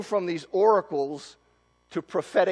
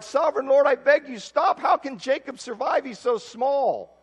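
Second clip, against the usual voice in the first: higher, louder desperate plea; crying out; 7 semitones higher, 3 dB louder than usual. Acted. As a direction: cry out to God to stop it. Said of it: "Then I cried".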